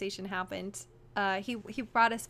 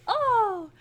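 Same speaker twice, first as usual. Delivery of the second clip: mock startled oh; 9 semitones higher, 5 dB louder than usual. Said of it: "No, that's not how I was like".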